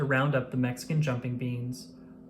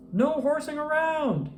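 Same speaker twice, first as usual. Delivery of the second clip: high, far-off megaphone call; through a megaphone; 12 semitones higher than usual. A narrator's reading. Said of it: "he called through his megaphone".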